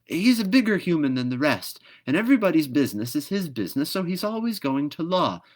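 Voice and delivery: high, loud, fast bird voice; brisk bird voice. Acted. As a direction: lecture like a bossy bird. Swallow explains.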